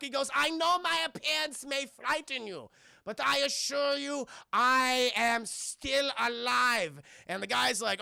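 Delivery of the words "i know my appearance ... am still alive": put-on much higher, slow, menacing German accent; hammed-up German mad scientist accent; evil German scientist accent. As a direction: sneer it as a cartoon German villain. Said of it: "stereotypical evil German Nazi scientist".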